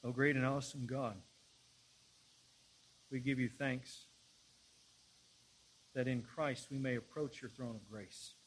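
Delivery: measured prayer, long pauses between phrases; solemn public prayer. Real, not acted. Preacher leading the church in prayer to God, solemn public prayer. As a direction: address God reverently in firm, spaced-out phrases.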